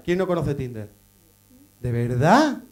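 normal talking voice

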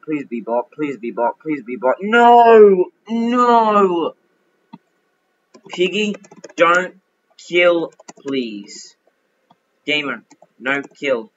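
ordinary delivery

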